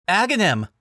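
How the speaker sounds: high, loud call across rubble; yelling a name. A narrator's reading. Link calling for his friend in the rubble, yelling. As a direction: shout for your missing friend. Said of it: "the young man yelled".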